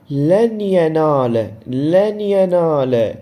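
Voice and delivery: "لن ينال" recited slowly, ن blended into a held nasal ي; idgham with ghunna. Shown as a demonstration. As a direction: merge the ن of لن into the ي of ينال with a drawn-out nasal hum. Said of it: "فإذا هاي إدغام بغنة". (إدغام بغنة ممدودة في «لن ينال»)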